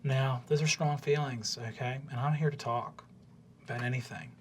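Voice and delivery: soft, probing counsellor voice; gentle gnome counsellor. Acted. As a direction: check in on them gently and quietly. Gnome counsellor offers to talk.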